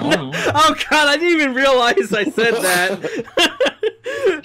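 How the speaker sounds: high, laughing through the words; slow, cracking up mid-sentence. Real, not acted. Laughing at own slip.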